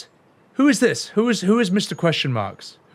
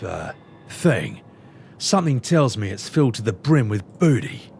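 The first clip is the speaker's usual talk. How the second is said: lower, menacing pirate captain voice; a pirate boss warning the player, cool threat; gruff sky pirate; 4 semitones lower than usual. Acted. Captain lecturing a rule-breaker, low and menacing.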